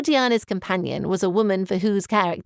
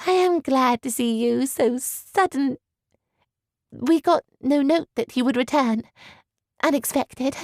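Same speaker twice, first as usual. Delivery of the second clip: higher, slow teasing lilt; smiling, playful query; smile in voice; 4 semitones higher than usual. A narrator's reading. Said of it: "queried with a smile in her voice".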